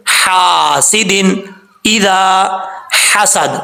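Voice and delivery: حاسد drawn out very slowly with a throat ha; correct recitation. Shown as a demonstration. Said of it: "اس کو کیسے پڑھیں گے؟". (حاسد کو آہستہ، حلق کی ہا سے)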